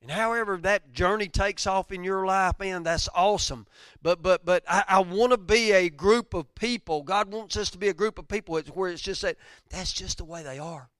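loud, high impassioned preaching; preaching at full force. Real, not acted. Pastor stirring his church, loud and high. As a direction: lift the congregation, voice soaring.

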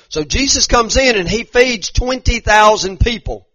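loud, climbing sermon voice; rising revival preaching; preaching at full force. Acted. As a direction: preach it out to the back row.

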